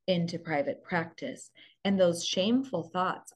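usual speaking voice

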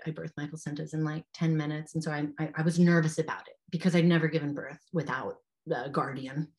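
ordinary delivery

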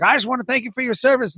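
high, quick Southern twang, earnest thanks. Retold or imitated. Caller thanking a veteran guest on air, in a Southern twang. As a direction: rattle off the thanks in a West Virginia twang. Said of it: "got a southern twang".

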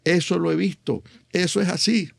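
high-pitched imitation of a delighted audience; euphoric recognition. Audience recognising their own world in the joke, euphoric. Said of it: "eh, euforia".

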